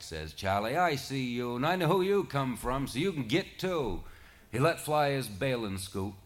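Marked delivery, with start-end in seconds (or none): 0.8-4.0 higher, louder stern brush-off; stern dismissal. A narrator's reading. Charlie sees through the mermaid's trick, loud stern brush-off. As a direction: send the temptress packing.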